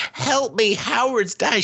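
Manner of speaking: high-pitched cry for help, hammed up; Pearl, mock panic. Retelling the wife's plea as her husband dies, mock panic. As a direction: imitate a desperate wife calling out.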